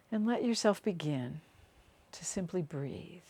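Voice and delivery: unhurried meditation voice with long pauses; guided meditation. Acted. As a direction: guide them gently down into the body, phrase by phrase.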